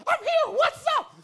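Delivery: put-on very high, loud squawky girl voice; Jamie's shrill voice. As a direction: squawk it like the odd girl from school. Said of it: "be like".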